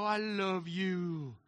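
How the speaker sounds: very slow, drawn-out coo; doting on the dog. Retold or imitated. An owner slowly coos his love to the new dog. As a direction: linger over every word to the pup. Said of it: "I look at this dog and I go".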